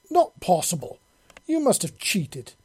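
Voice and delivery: high, loud disbelief; loud accusing outburst; shocked exclamation. A narrator's reading. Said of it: "she exclaimed when she saw".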